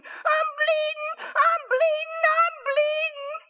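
put-on a hurt little boy wailing in panic; crying out high and shrill with fear; panicked screaming.

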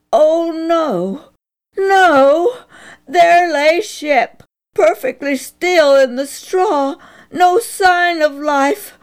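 high, loud, slow narration breaking with alarm; shocked dread. A narrator's reading. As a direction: read it as if your heart just dropped. Narrator finds the dog lying still.

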